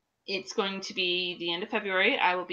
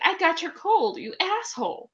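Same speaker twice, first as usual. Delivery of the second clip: higher, mock outrage at the husband; mock angry; 7 semitones higher than usual. Wife blaming her husband for the cold, mock angry and higher. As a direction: fake fury, playful. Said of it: "and I'm like".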